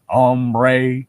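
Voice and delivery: Texan drawl on Hombre, the h silent, oh-m-bray; Texan Spanish word. Retold or imitated. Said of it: "stereotypical Texan thing of, like, just saying".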